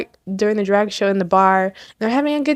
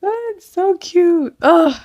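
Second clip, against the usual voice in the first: slow, higher gushing sigh; 7 semitones higher, slower than usual. Real, not acted. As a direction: melt over how cute it is. Gushing after laughing.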